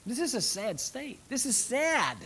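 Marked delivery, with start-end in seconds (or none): none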